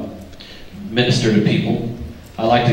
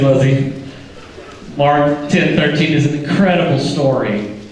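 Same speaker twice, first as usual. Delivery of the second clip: ordinary delivery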